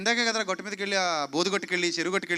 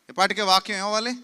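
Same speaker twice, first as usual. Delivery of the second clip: ordinary talk, nothing marked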